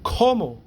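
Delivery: cómo with a high first O, falling after; stress on first O. Shown as a demonstration. Teacher showing the accent, cómo stressed on the first O. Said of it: "another accent after the first O".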